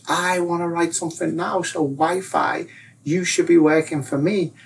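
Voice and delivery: high, self-pitying complaint; mock whining. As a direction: demand it like a hard-done-by victim.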